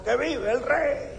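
high parade cheer. Retold or imitated. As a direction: call it out like a parade crowd.

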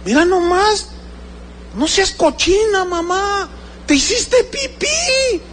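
very high-pitched, disgusted nagging; shrieky-high reproach, drawn out at the end; mock scolding. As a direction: mimic a child shaming elderly mother. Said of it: "ahora me regañan".

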